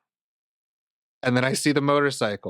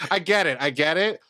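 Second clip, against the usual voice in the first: usual speaking voice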